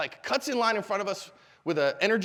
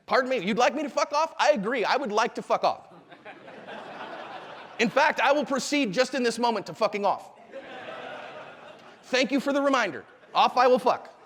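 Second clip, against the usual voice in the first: higher, formal comic politeness; mock-polite agreement; 5 semitones higher than usual. Shown as a demonstration. Teacher demonstrating agreeing with an insult, mock-polite. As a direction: accept the insult with elaborate courtesy.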